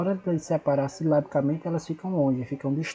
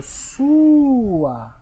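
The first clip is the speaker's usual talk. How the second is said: sua drawn out long and slow, a bit louder; said slowly and stretched; 7 semitones higher, 8 dB louder than usual. Shown as a demonstration. Teacher demonstrating slow spoken separation. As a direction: stretch sua so the split is heard. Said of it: "falar ela pausadamente ou de forma bem alongada".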